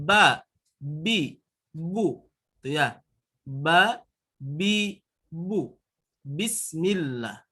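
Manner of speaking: normal talking voice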